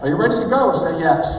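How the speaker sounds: loud, high hype call; rousing call-and-response demo; rallying tie-down. Shown as a demonstration. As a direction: fire up the crowd for a yes. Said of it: "I just did it right there".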